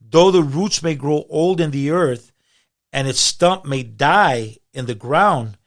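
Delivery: loud, slow, declaimed Bible verse; emphatic scripture reading. Acted. Guest reading Job to the host, emphatic preaching. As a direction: proclaim it slowly, hitting key words hard.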